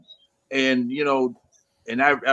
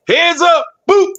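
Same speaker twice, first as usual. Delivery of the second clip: playful warning; 7 dB louder than usual. Retold or imitated. Mimics warning before football hit.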